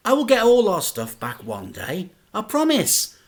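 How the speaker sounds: a son promising his sad dad, rising with hope; boy's earnest promise. A narrator's reading. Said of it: "the boy would say".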